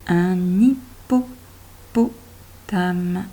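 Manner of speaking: "Un hippopotame" drawn out very slowly, with the n linked onto hippopotame; slow teacher reading for children; slow liaison demo. Shown as a demonstration. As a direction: stretch every syllable so the link is clear. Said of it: "Celui-là, il est bien muet".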